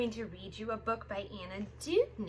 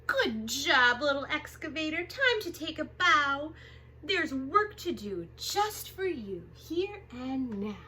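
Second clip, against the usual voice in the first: lively picture-book read-aloud; reading to children. Acted. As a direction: read it big and bouncy for little kids.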